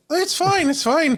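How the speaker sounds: high, loud mock reassurance; loud high-pitched Irish guide impression; Irish tour guide. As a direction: wave worries away as an imitated guide. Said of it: "He's this Irish guy".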